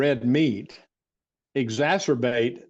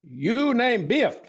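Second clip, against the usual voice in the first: higher, slow fond imitation of an old healer; silly voice; 8 semitones higher, slower than usual. Recalling the barefoot doctor at his bedside, in a higher silly voice. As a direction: lift the voice up and take it slowly. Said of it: "the silly voice I'm doing".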